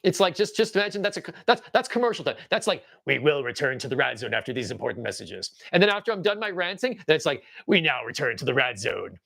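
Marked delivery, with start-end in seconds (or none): 3.0-5.5 low announcer voice for a commercial break; mock broadcast bumper, pitched down; Victor Carlotta. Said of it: "imagine I'm using Victor Carlotta's voice". 7.7-9.2 low announcer voice for a commercial break; mock broadcast bumper, pitched down; Victor Carlotta. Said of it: "imagine I'm using Victor Carlotta's voice".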